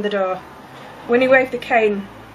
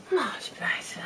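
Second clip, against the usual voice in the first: much softer, whispered "Much better"; whispered relief; 9 dB quieter, slower than usual. Real, not acted. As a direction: breathe it out in relief. Relief after removing shoes.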